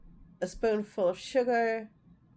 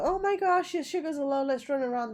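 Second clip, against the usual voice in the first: high, loud mock panic that fades; 6 semitones higher, 3 dB louder, faster than usual. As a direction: squeal it like a frantic parent, then trail off. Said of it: "I don't go".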